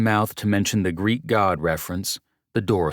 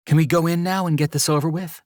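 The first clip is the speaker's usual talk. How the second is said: high, fast and impatient; 11 semitones higher, 4 dB louder, faster than usual. Acted. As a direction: rush it, fed up.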